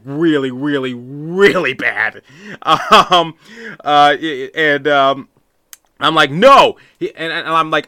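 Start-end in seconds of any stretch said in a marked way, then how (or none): 6.3-6.8 loud, high shout of no; yelling no. Retold or imitated. Fan yelling back at a parent, loud and high. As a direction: shout him down. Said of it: "I'm yelling at the adult".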